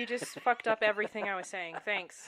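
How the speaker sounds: loud, fed-up rebuke; exasperated. Real, not acted. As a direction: blame your co-host loudly for derailing you. Blames co-host for derailing the point.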